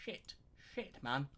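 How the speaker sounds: a quiet mock curse at a cancelled lesson; retold quiet swearing to himself; muttered curse. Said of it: "I'm like".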